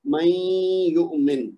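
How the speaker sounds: من یؤمن drawn out very slowly, the noon merged into یؤمن; ادغام کے ساتھ من یؤمن; slow tajweed recitation. Shown as a demonstration. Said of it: "اس کو بولنے کی کوشش کریں".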